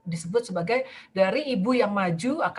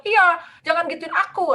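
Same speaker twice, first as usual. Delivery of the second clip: anak kecil menirukan; 8 semitones higher, 5 dB louder than usual. Retold or imitated. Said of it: "anak kecil itu bilang".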